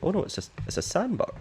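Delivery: high, quick surprised exclaim. Real, not acted. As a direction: caught off guard, voice jumps up. Spots sandbug, not a dhow.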